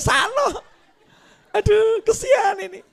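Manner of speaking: high, loud laughing pity; laughing mockery. Onlookers mocking a man barking at a dog, laughing. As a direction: laugh at the fool barking back.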